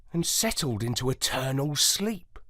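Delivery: ordinary delivery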